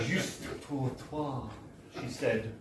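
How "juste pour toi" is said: slow, softer, lightly voiced flirtatious French; flirty French barmaid. Barmaid offering celery to the narrator, lightly flirtatious. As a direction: purr it slowly with a wink. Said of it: "longest, leeriest wink known to man".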